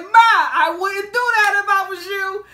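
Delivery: loud, high, drawn-out retold warning; called-out warning. Man warning a woman across the train, loud mock call. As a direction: call it across the carriage, slowly.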